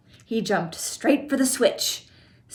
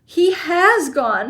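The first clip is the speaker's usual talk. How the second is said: slow, high stunned exclamation; shocked shout; 8 semitones higher, 5 dB louder, slower than usual. A narrator's reading. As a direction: drag it out in disbelief. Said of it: "shouted Mister T V".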